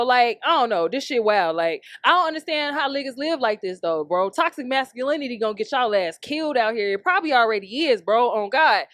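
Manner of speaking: normal talking voice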